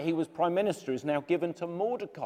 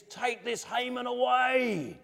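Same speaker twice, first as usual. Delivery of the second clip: commanding king; 8 semitones higher, slower than usual. Acted. King orders Haman removed.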